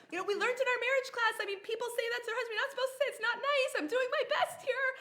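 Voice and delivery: high, loud protest with laughter in it; hammed-up self-defence, pitched right up; mock indignant blaming. Bad driver blaming the road to her husband, laughing mock indignation. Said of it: "I was blaming everyone else".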